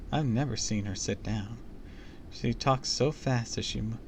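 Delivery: usual speaking voice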